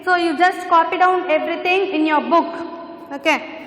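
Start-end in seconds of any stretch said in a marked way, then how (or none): none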